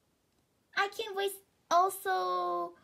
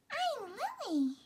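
put-on a lightly higher, slow girlish toon voice; Lily voice; 9 semitones higher, 4 dB quieter, slower than usual. Kid redoing the Lily character's line, lightly higher toon voice. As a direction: say it sweetly as little Lily. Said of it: "[name] sounds like Lily".

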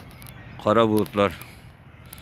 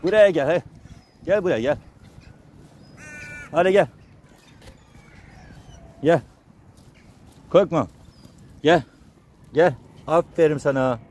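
coaxing animals; 6 semitones higher than usual. Real, not acted. Feeding timid strays, calling them over gently, high sing-song coaxing. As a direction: coax a shy stray dog to come close.

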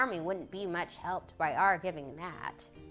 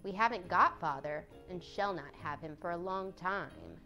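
sadly, falling away softly at the end; understated sadness; 4 semitones lower, 6 dB quieter than usual. A narrator's reading. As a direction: let it sink low, with mild sorrow. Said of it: "Jo said sadly".

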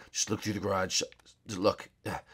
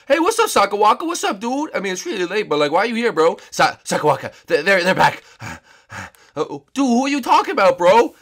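a sleepy bro, pitched up and loud; high-pitched dude voice; 9 semitones higher, 7 dB louder than usual. Acted. Woken friend greeting Sakawaka in a high, loud dude voice.

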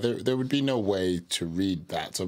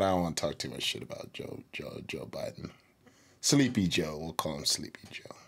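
muttered quieter aside; 4 semitones lower, 5 dB quieter than usual. Real, not acted. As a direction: drop the voice, half to yourself. Aside while backing off.

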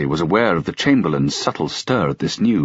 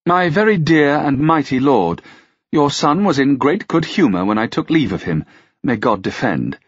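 courtly lady; 7 semitones higher than usual. A narrator's reading. Melanthe addresses the king.

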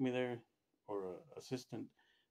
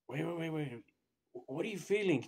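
urgent retold nurse, pitched up; frantic nurse; 5 semitones higher, 3 dB louder than usual. Nurse stopping a treadmill test, mildly frantic. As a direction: imitate a nurse urgently checking on a patient. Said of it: "the nurse starts going crazy on me".

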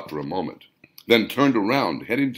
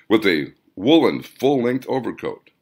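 ordinary delivery

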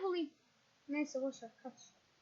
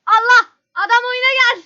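shouting; 5 semitones higher, 12 dB louder than usual. Real, not acted. Yelling at AFK teammate.

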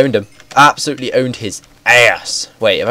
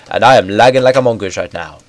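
ordinary talk, nothing marked

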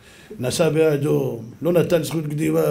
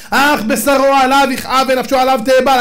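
forceful quotation; 7 semitones higher, 5 dB louder than usual. Acted. Rabbi to the mourners, declaiming the verse loudly. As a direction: proclaim the verse loudly and higher.

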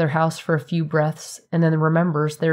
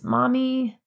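put-on high, whining little girl calling for her mom; a toddler calling out from her nap; sleepy child; 8 semitones higher than usual. A narrator's reading. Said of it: "tosses and turns and says".

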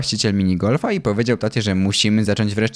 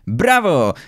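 higher-pitched acted cheer; a moderately raised shout of approval; cheering call; 5 semitones higher, 4 dB louder than usual. A narrator's reading. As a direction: call out bravo like a supportive boy. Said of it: "zawołał do taty Fabrycy".

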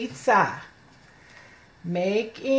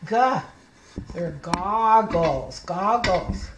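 "goggles" drawn out slowly and clearly; slow clear word; 5 semitones lower, slower than usual. Shown as a demonstration. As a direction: stretch out "goggles" so the child hears the G. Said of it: "They start with a G".

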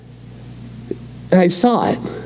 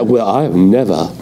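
low, scoffing self-righteous denial; smug denial; 9 semitones lower than usual. Retold or imitated. Preacher mocking a listener's denial, low and scoffing. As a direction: scoff as if above it all. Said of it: "if you go over and say".

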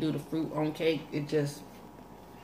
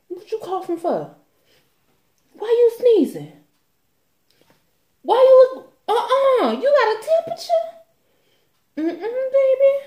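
high, loud suspicious grilling with laughs; nosy high voice; 9 dB louder than usual. Retold or imitated. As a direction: imitate a nosy busybody. Imitates nosy people.

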